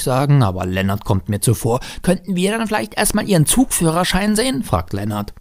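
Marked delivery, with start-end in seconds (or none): 2.0-4.6 put-on high-pitched little boy demanding; child challenging. A narrator's reading. Boy turning tables on train driver, high challenging child voice.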